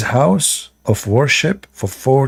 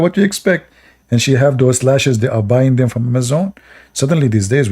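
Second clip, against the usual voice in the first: ordinary talk, nothing marked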